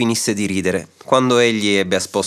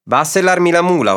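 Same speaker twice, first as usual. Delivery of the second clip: higher-pitched, brisk command; ordering; 6 semitones higher, 3 dB louder than usual. A narrator's reading. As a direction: give the farmhand an order. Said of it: "ordinò al contadino". (ordine perentorio, tono più alto)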